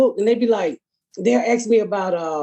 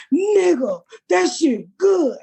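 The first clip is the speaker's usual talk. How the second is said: gushing fan; 8 semitones higher, much slower than usual. Retold or imitated. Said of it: "keep walking up to me saying".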